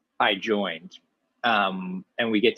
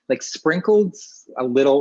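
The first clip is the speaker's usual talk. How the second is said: usual speaking voice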